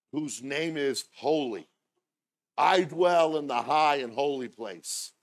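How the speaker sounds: loud, slow scripture proclamation; preaching. Acted. Preacher proclaiming the high and holy one to church, loud preaching. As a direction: declaim it high and loud, drawn out. Declaims scripture at full force.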